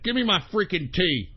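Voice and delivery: ordinary talk, nothing marked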